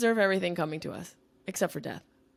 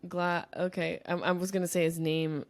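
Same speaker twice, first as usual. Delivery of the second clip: ordinary talk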